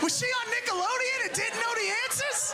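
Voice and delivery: high, shouting disbelief; grossed-out man. Retold or imitated. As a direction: squeal at the gross discovery. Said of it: "Remember that first discovery?".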